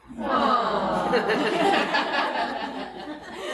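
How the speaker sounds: high, rising laughter; laughing at the students' cooing; real laughter. Real, not acted. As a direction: laugh along at the sounds. Class makes tender sound.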